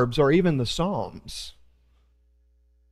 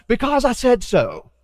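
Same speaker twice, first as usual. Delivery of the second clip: a hint of a stern father, pitched up; imitating dad; 6 semitones higher than usual. A pastor mimicking his dad shutting down a child's whys. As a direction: say it like a tired dad ending the argument. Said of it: "I sound just like my dad".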